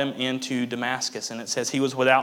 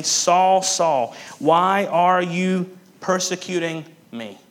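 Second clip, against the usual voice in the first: louder, higher call, the question slow; 5 semitones higher, 4 dB louder, slower than usual. Acted. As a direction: cry out his name, then ask slowly. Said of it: "And what does Jesus say?".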